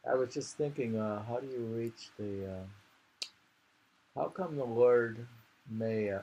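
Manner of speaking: usual speaking voice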